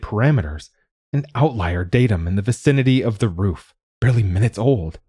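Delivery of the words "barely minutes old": ordinary delivery, nothing marked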